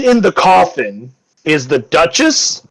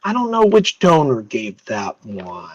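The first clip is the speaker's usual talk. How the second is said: slow, musing noblewoman; 5 semitones higher, 5 dB quieter, much slower than usual. Acted. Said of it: "she goes".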